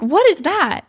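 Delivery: high-pitched, startled disbelief; retold bewildered question. Woman recalling her own confused reaction, bewildered. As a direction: jump up in pitch, baffled.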